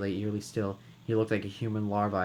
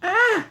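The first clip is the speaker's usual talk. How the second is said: high shriek of terror; terrified scream; 11 dB louder than usual. Acted. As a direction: shriek in fear. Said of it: "Another scream filled the room".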